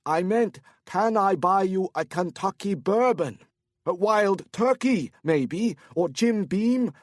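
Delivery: put-on keen Korean-accented host; tipsy Korean businessman. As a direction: drunk man offering bourbon, shouting over music. Korean man character voice.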